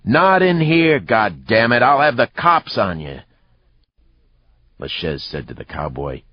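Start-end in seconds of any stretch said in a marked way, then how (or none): 0.0-3.2 high, loud threatening shout; bartender's yell. A narrator's reading. Bartender threatening brawlers with the police, yelling. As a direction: holler a warning across the bar. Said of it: "The bartender yelled".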